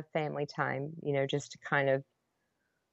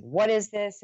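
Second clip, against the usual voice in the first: higher, louder furious demand; retold yell; 6 semitones higher, 5 dB louder than usual. Wife yelling at her husband over the jars, furious. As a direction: yell it at him, outraged. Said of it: "I'm yelling".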